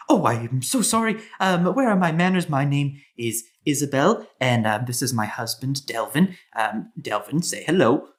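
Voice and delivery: apologetic hostess making hurried introductions; older female dwarf. Acted. Dwarf mother introducing her husband to guests, lightly flustered. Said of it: "the older female dwarf says".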